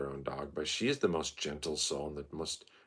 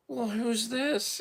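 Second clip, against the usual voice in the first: high, drawn-out coo to a dog; very high, slow fussing over a dog; dog-greeting voice; slower than usual. Acted. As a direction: greet a strange dog in squeaky-high baby talk. Cooing greeting to a stranger's dog.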